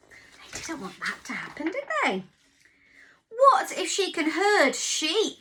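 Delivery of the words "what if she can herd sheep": higher, louder, slower worried dog. Acted. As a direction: voice the anxious sheepdog. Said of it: "said Dog".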